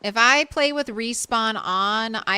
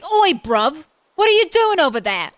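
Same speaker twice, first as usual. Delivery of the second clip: mock British shout, Oi, bruv pitched up and louder; 6 semitones higher, 6 dB louder than usual. Streamer imitating a British shout at someone over there. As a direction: yell like a London lad across the street, British. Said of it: "that's shouting at someone like".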